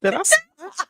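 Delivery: high, loud, hammed-up disbelief; mock surprise. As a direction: play innocent, shocked at your own words. Feigned surprise at her note.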